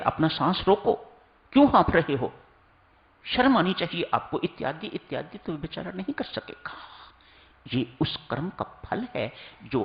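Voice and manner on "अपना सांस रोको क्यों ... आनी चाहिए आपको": higher-pitched mock rebuke; mock scolding. Preacher voices someone scolding a breathless runner, mock scolding. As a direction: act out a reproach, raised in pitch. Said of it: "आपको कहा जाए".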